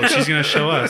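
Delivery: high, loud mocking through laughter; laughing sarcasm.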